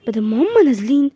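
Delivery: ordinary delivery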